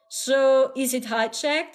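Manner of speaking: ordinary delivery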